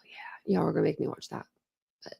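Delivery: breathy unvoiced whisper. Real, not acted. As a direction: whisper it under your breath. Whispered aside to viewers.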